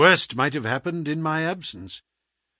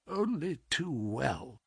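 very soft, slow reply; weak, sickly; 2 semitones higher, 11 dB quieter, slower than usual. Acted. Sick man admits knowing the symptoms, feebly. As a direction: let it out feebly.

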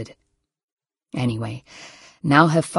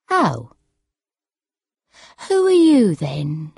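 put-on puzzled old mother's voice; bewildered elderly mother. A narrator's reading. Mother fails to recognise her own daughter, bewildered. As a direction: ask as a mother who has lost track.

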